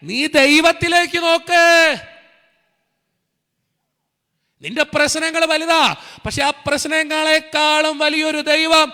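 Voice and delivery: high, loud, ringing preacher's cry; rising revival sermon, voice lifted high and loud; fiery preaching. Acted. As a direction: preach it out to the whole hall at full force. Full-force preaching climax.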